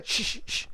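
sharp repeated shushes; string of insistent shushes; shushing. Real, not acted. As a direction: hush someone who is cutting in. Hushing an interrupter.

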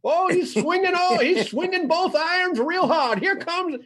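put-on cackling old-timer calling the showdown; old prospector. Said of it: "It's the old prospector".

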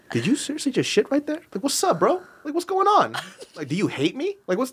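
high, squeaky exasperated scolding of a pet; scolding the dog. Retold or imitated. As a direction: scold the dog like a disappointed parent. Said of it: "talking to her when she makes me mad".

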